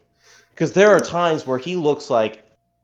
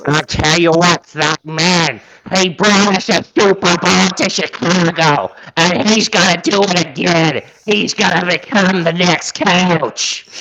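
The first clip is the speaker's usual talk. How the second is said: a hint of a Chicago accent, higher and louder, slowing at the end; bad Chicago accent; 6 semitones higher, 4 dB louder than usual. Podcast host hyping up coach Ditka, in a mock Chicago accent. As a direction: hype up Ditka like a would-be Chicago local, lightly accented. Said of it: "I can't do a Chicago accent to save my life".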